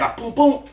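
high boom boom for the punches; punch sound effects. Retold or imitated. Replaying the fight's punches, high boom sounds. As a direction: snap out the punch noises high.

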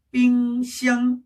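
"삥샹" as two held level notes; double first tone. Shown as a demonstration. Teacher modelling fridge in Chinese, "삥샹" both syllables level. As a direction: keep both "삥" and "샹" equally high and unwavering. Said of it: "이렇게 되겠죠".

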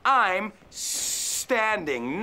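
"I'm standing," said slowly with a long s; slow stretched s. Shown as a demonstration. Teacher slowly models "standing" for learners. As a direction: drag out the s of "standing". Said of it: "Extiende la S".

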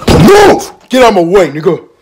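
very high yelled order, easing down; loud, high outburst; angry shout. Acted. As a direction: yell to clear the path. Acted confrontation with someone in way.

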